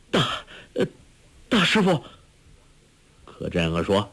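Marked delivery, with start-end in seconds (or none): 0.1-2.0 halting, high, shocked stammer; trembling. A narrator's reading. Shocked disciple addresses his master, trembling. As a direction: falter on the words in dread. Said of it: "颤声说".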